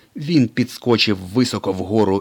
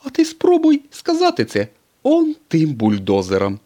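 much higher, louder sly voice; Mater suggesting; 10 semitones higher, 7 dB louder than usual. A narrator's reading. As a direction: dare him slyly. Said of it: "запропонував Сирник".

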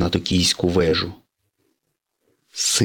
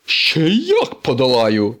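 raised, drawn-out retort; defiant declaration; 5 semitones higher, 3 dB louder, slower than usual. Acted. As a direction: answer the challenge with emphatic confidence. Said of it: "заявив сирник". (впевнене протяжне заперечення)

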